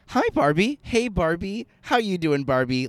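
high, loud sing-song doll greetings; Barbie. Acted. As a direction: chirp hello like one Barbie to another. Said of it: "like they were fucking Barbie".

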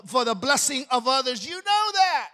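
loud, forceful preaching. Acted. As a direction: preach it hard to the congregation.

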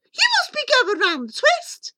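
high, loud scolding mother; scornful hiss. A narrator's reading. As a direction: scold the boy in disbelief. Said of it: "his mother hissed".

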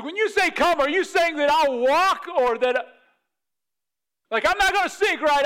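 high, loud nervous checking; anxious questioning. Acted. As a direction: demand reassurance before stepping out. Imagines nervously questioning Jesus' command.

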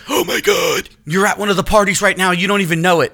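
high, loud shocked exclamation; excited shout. Retold or imitated. Wife shouting to husband that he's at the party, high and loud. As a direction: blurt out the discovery.

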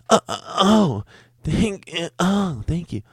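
very high, squeaky stammered thanks; mock customer moan. Customer thanks waiter for refill, hammed-up high squeak. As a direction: ham up the customer's breathless gratitude. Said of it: "That's what he says".

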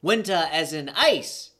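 high, loud Jamaican character voice; Jamaican accent. Acted. Teammate questions the winter sport, heightened Jamaican accent. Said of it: "I gotta do an accent and a character".

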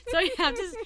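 loud, high and laughing; delighted laugh. Real, not acted. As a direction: burst out delighted, fast. Delighted at partner's pick.

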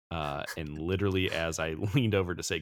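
ordinary talk

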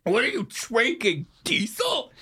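high, strained, slow choked question; strangled, appalled splutter; choking on liquor. Acted. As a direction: squeeze it out through a burning throat. Reacting to harsh liquor from flask.

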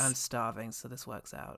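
a hint of a sleepy, mumbled line; lightly voiced drowsy movie extra; sleepy hungry guy. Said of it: "he's really sleepy as he says it".

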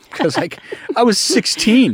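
laughing. Real, not acted. Laughing embarrassed confession.

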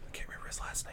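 soft unvoiced whisper. Real, not acted. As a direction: whisper it under your breath. Forgetting name, aside.

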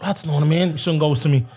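ordinary delivery

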